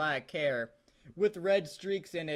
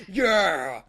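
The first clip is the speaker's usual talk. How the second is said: mock roar; 7 dB louder than usual. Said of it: "I roared".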